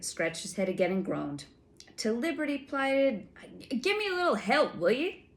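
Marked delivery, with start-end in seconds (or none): 2.0-5.3 flustered giant. A narrator's reading. Giant struggling with password.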